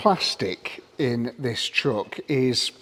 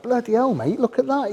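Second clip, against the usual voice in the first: higher-pitched, imitating his own annoyed outburst; retelling his pissed-off reaction to the dealer, pitched up; exasperated outburst; 10 semitones higher than usual. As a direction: replay the moment you spotted the bad fitment. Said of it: "I was like".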